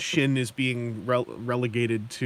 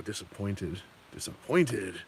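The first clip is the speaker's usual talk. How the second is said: Kevin Sorbo disappointed; 4 semitones lower, 4 dB quieter, slower than usual. Retold or imitated. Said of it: "Kevin Sorbo gif".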